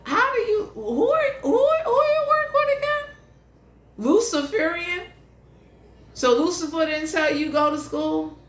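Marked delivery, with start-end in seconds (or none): 0.0-5.0 high, incredulous mock voice; squeaky incredulous put-on voice; mock disbelief. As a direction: act baffled at someone's odd claim. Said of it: "they looking at somebody like".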